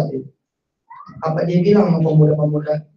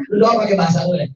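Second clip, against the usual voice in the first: ordinary talk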